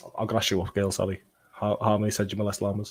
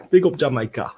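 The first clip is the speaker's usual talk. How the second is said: badly attempted Jamaican accent, louder; hammed-up, clumsy Jamaican accent; awful Jamaican accent; 2 semitones higher, 5 dB louder than usual. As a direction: throw out a poor Jamaican accent, falling at the end. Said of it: "That's an awful accent".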